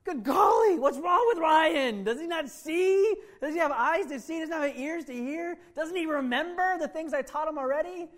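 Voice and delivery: high, loud, slow mock shout from heaven; hammed-up heavenly exasperation; Jesus complaining to the angels about Ryan, hammed-up loud; exasperated Jesus. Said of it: "Up in heaven with the angels".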